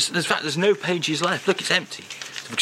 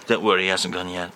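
ordinary talk

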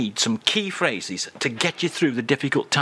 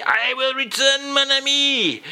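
much higher, grand farewell; dramatic farewell; 11 semitones higher than usual. Acted. [name] signs off to host, grand high farewell. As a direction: exit like a hero.

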